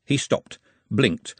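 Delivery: plain reading voice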